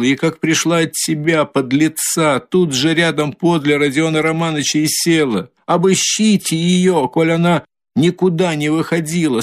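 high, frantic, desperate tirade; hysterical fury. A narrator's reading. Katerina frantic, threatening to run to the Tsar. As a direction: drive it up into hysteria.

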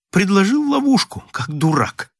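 high-pitched exasperated self-reproach; narrated exasperation, starting high; venting frustration. A narrator's reading. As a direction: vent at your own foolishness, pitch leaping up. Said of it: "отводил душу в ругательствах".

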